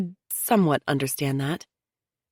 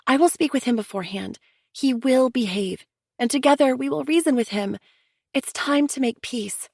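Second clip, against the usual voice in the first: higher, earnest sister-in-law pleading for unity; Chelsea, insistent; 9 semitones higher than usual. A narrator's reading. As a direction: press them to sit together like family. Chelsea voiced, persuading.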